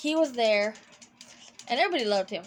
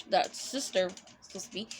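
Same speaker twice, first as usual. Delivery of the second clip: ordinary talk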